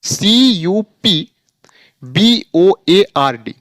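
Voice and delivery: slow letter-by-letter dictation; slow spelling. Shown as a demonstration. Teacher spelling cupboard slowly for students. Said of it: "spelling".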